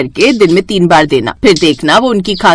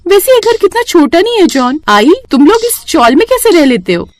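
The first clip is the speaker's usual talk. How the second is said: snooty bride; 9 semitones higher than usual. Acted. New bride mocks small house.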